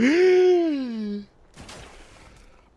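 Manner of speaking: loud, high desperate gasp for breath; waking gasp. Retold or imitated. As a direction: gasp like you just woke up choking. Waking up choking.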